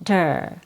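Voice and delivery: low, soft "der" with a quick d; weak "der" with a tapped d; fast D. Shown as a demonstration. Teacher isolating the last syllable of computer, fast D. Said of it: "has a fast D sound".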